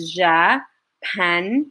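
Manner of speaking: "Japanese" split slowly into three beats; syllable by syllable. Shown as a demonstration. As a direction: say "Japanese" as Ja, pa, nese with pauses between. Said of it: "How many syllables are there".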